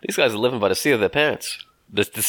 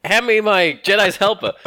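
mock Luke; 9 semitones higher, 3 dB louder than usual. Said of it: "Luke was like".

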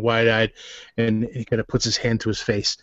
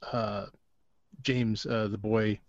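usual speaking voice

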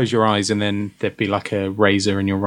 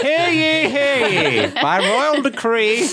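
town crier; much slower than usual. Pretend. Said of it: "Anna's now a town crier".

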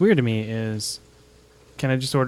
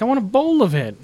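put-on kid voice; 10 semitones higher, 6 dB louder than usual. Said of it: "And he's like".

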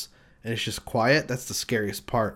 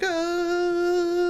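very high, sustained "Ah!" in a strange voice; high mock yell. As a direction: wail it like a family scaring off a deer. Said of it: "They're like".

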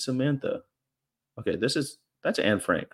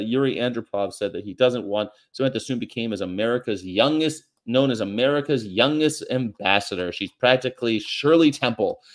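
normal talking voice

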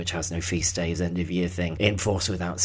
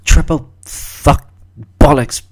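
loud exasperated cursing; swearing outburst; 8 semitones higher, 15 dB louder, slower than usual. Real, not acted. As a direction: spit out the curses loudly. Startled by the ringing phone.

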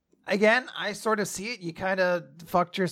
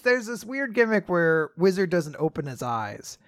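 ordinary delivery

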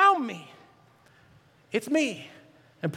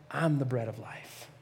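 hushed declaration; 12 semitones lower, 10 dB quieter than usual. Retold or imitated. Jesus quoted, hushed.

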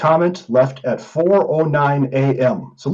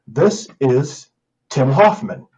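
ordinary talk, nothing marked

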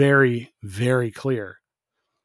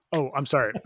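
ordinary talk

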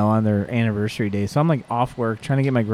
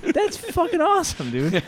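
much higher, slow and starstruck; gushing fan; slower than usual. Retold or imitated. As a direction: gush at him like a fan. Host gushes at the brewer.

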